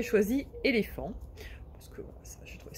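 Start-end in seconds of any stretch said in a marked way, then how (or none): 1.7-2.7 soft unvoiced whisper. Real, not acted. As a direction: whisper it like a little secret. Aside about choosing the word éléphant.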